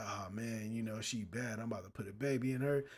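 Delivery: hushed lovestruck impression, fast at the end; mock dreamy young guy. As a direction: drop low and soft like a guy hooked on a girl. Mocking a smitten young guy.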